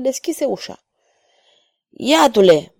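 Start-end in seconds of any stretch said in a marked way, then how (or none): none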